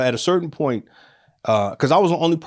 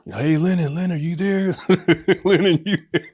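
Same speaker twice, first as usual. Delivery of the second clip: raised, higher call of a name; calling through a door; 6 semitones higher than usual. Retold or imitated. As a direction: call out to someone behind a door.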